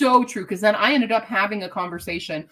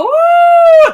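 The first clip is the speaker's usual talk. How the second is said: excited whoop; 11 dB louder than usual. Real, not acted. Cheering for favourite book.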